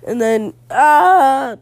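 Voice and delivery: long, loud drawn-out yell. Real, not acted. Boy answering a beach hack question, yelling. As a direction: break into a sustained loud "ah". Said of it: "Stop yelling".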